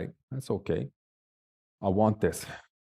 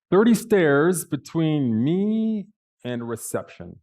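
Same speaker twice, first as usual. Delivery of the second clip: Thirty stretched slow, higher and louder; dismayed emphasis; 6 semitones higher, 5 dB louder, slower than usual. Acted. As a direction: land the disbelief on Thirty.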